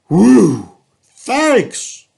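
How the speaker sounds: loud, high sigh of relief then thanks; relieved elephant. Acted. Elephant thanking the boys, loud high relief. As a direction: let the relief burst out.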